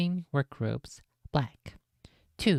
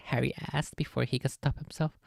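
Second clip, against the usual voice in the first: plain reading voice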